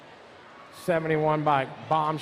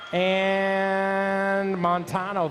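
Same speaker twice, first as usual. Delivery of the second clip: drawn out; much slower than usual. Shown as a demonstration. Announcer repeats rider name.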